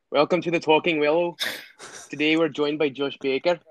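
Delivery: put-on Scottish podcast intro; host the show in a Scottish accent, rising at the end. Shown as a demonstration. Host welcomes Josh Baker to the podcast, Scottish accent. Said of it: "I can do Scottish".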